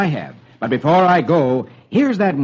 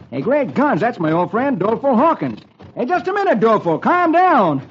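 higher, hearty cowboy voice; cowboy hero Roy; 7 semitones higher than usual. Acted. Roy to his frantic old friend, hearty cowboy hero. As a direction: call out to an old friend in surprise.

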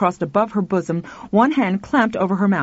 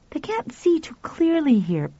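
put-on lightly higher boy, calm; matter-of-fact; boy's voice; 8 semitones higher than usual. Pal undisturbed after the concert, light boy voice. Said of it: "Pal said".

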